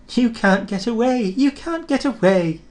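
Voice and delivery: high teasing coaches singsong; mocking coaches. A narrator's reading. Coaches jeering at trapped Thomas, high and mocking. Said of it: "laughed the coaches".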